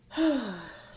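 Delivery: audible falling exhale; frustrated sigh. Real, not acted. Thinking about people who show initiative, frustrated sigh. Frustrated pause while venting.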